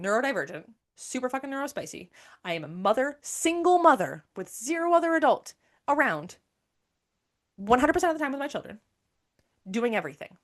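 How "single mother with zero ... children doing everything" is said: raised voice, higher and louder; exasperated venting. Real, not acted. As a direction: push it out emphatically, loud and high. Venting about parenting entirely alone.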